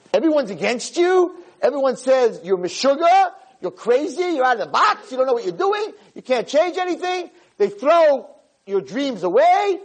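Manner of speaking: high-pitched pile-up of scornful accusations; worked-up voice of the naysayers; impassioned retelling. Retold or imitated. Voicing the mocking crowd's discouragement.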